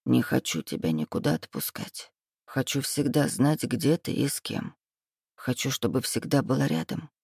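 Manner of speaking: a hint of a rasping demon, murmured close; hoarse demon voice. A narrator's reading. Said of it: "услышала я хриплый голос демона".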